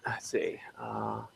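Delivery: whispered thinking. Real, not acted. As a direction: mutter it to yourself. Thinking aloud softly.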